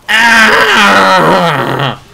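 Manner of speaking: a mock climax cry voicing Johnny. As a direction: belt out a silly orgasm cry. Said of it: "And Johnny's like".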